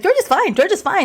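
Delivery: high, airy brush-off of the heat; breezy dismissal. Retold or imitated. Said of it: "me and everybody, like".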